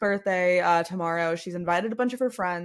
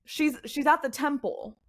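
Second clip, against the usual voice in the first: higher-pitched townsman's voice; helpful townsman; 7 semitones higher than usual. Acted. Townsman directs visitors to the temple, higher-pitched and helpful. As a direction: point strangers onward in a raised pitch. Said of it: "He goes".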